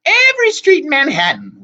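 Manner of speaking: put-on high, loud bragging old sister; bragging hag. A narrator's reading. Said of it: "Wasp bragged".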